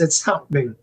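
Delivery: ordinary delivery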